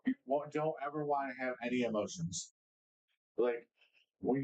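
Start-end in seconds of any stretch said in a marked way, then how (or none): none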